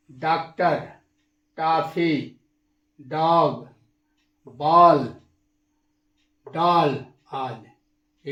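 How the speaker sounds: डॉक्टर, टॉफी, डॉग with the vowel between aa and o; English aw sound. Shown as a demonstration. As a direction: open o of English in बॉल and डॉल, slowly. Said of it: "आ और ओ के बीच किया जाता है".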